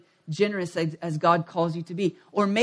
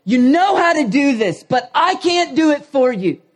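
higher, louder coach pleading; exasperated coach; 6 semitones higher, 5 dB louder than usual. Retold or imitated. Coach telling players the play is simple, higher exasperated plea. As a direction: insist it's simple, fed up. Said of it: "he's like".

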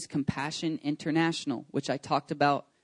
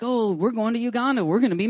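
higher, fast gushing excitement; excited; 7 semitones higher, 3 dB louder than usual. Retold or imitated. Pastor mimicking missionaries' blog, bubbly and excited. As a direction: rattle it off like thrilled news.

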